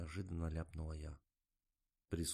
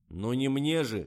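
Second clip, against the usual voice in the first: ordinary delivery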